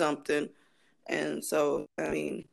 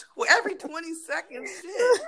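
laughing outrage; 10 semitones higher, 4 dB louder than usual. Real, not acted.

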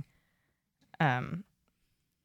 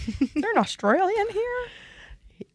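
mock call out; slower than usual. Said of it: "mock call-out".

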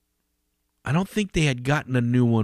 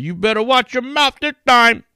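mock threat; 12 semitones higher, 4 dB louder than usual. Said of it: "And he's like".

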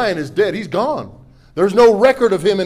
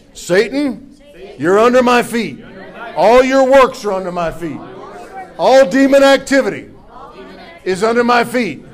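loud, rising declaration against Satan; preaching at full force; 3 semitones higher, 6 dB louder than usual. Acted. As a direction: proclaim victory over the devil, louder each time.

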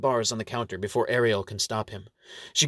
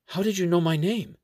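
higher-pitched puzzled query; surprised question; 7 semitones higher than usual. A narrator's reading. Dwarf clerk asks the nun, surprised. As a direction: voice lifts in surprise.

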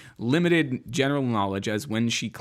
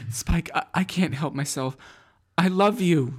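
higher mock confession; lovestruck Buffy; 6 semitones higher than usual. Buffy bot confesses love to Spike, higher and hammed up. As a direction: swoon it, hammed up.

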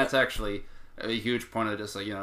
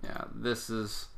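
ordinary talk, nothing marked

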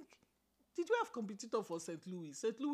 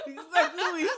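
laughing; 6 semitones higher, 10 dB louder, slower than usual. Real, not acted. Laughing correction.

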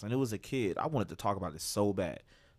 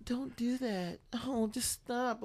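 high, soft pleading; half-hearted scolding of a kid; a hint of a helpless mother; mock meek mom; 13 semitones higher, 4 dB quieter, much slower than usual. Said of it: "she's like".

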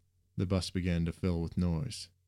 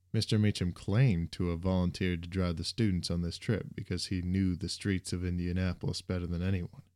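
plain reading voice

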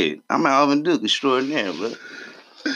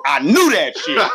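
laughing; 6 semitones higher, 3 dB louder, slower than usual. Real, not acted. Caught out on the album.

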